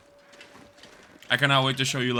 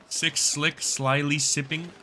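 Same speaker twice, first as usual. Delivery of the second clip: slow careful reading; slower than usual. Shown as a demonstration. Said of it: "A tongue twister".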